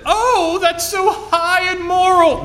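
very high mock-swooning wife voice; imagined wife's exaggerated high-pitched approval; mock admiration. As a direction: gush it far up high, hammed-up. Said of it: "you can imagine how she said".

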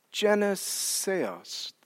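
very slow, hushed "Geneseos"; slow Greek reading. Shown as a demonstration. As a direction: stretch "Geneseos" right out, softly. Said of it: "read it again slowly in Greek".

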